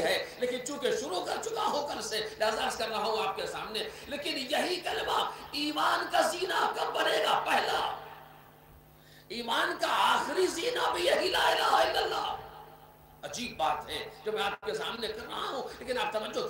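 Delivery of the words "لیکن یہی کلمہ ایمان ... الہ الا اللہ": raised, ringing sermon voice; forceful preaching. Acted. Preacher urging his congregation, forceful. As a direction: drive the kalima home to the hall.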